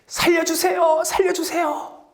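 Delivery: high-pitched, acted cry for mercy; pleading wail. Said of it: "하면서 막 울부짖는 거였습니다".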